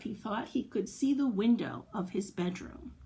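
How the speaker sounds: usual speaking voice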